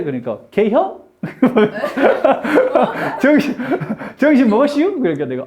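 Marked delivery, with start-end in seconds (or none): 0.5-1.0 short two-syllable Chungcheong question; fast Chungcheong dialect. Retold or imitated. Imitating a Chungcheong acquaintance asking teacher, quick dialect. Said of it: "충청도 분 정말 말 빨라요". 3.2-5.0 higher-pitched Chungcheong question; Chungcheong dialect. Retold or imitated. Imitating an acquaintance asking about lunch, Chungcheong dialect. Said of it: "충청도 분 정말 말 빨라요".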